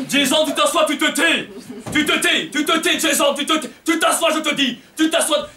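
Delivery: scolding parent. Acted. Mother on the train scolding her son Jason, repeated nagging. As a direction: bark orders at a restless kid.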